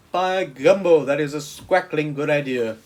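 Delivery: booming, delighted giant voice; a big friendly giant thrilled by the idea. A narrator's reading. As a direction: burst out with the giant's glee.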